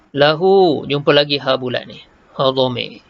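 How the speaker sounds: ordinary talk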